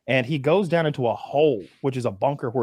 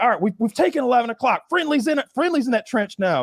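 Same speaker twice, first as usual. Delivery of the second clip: high, fast radio update; radio report; 9 semitones higher than usual. Retold or imitated. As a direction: rattle off the report to command. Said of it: "he's like".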